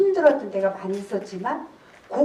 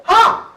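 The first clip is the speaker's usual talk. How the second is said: one loud, high HA!; big laugh; 8 semitones higher, 7 dB louder than usual. Shown as a demonstration. Laughter coach showing a big laugh to the class, loud. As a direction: bark out a single loud laugh. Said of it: "크게".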